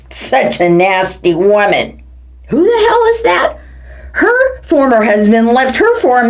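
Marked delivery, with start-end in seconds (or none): none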